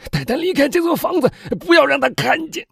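loud, high desperate command; frantic orders. A narrator's reading. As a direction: order the servants urgently, at full voice. Father frantically orders servants. (慌乱地高声命令)

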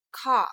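car as an open British "cah", no R; British pronunciation. Shown as a demonstration. Teacher showing British pronunciation, car without a curled tongue. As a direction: drop the final R of car, British style. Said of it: "英式发音".

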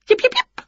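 three quick squeaky yelps; animal yips. A narrator's reading. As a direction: yelp very high like a small creature. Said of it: "came a noise from the woods".

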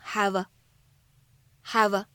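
"have" joined into the a as "hav-a", slow; linked have a. Shown as a demonstration. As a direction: glide "have" straight into a. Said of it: "我们呢可以直接读成".

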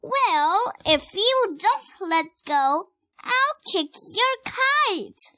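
high, loud, slow yell. Acted. Rosie answering her twin's threat, yelling. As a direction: yell the threat back, stretching the words. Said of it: "yelled Rosie".

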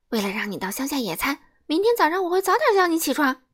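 excited quick chatter; fast eager promise. Acted. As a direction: rattle off the wake-up plan. Mr. Busy plans eagerly.

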